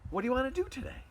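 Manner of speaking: high-pitched waking dog voice; high, eager just-woken dog. Acted. Dogs waking up in their house, waking voice.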